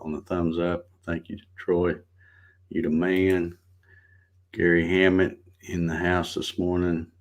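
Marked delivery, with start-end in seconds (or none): none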